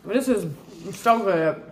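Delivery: ordinary delivery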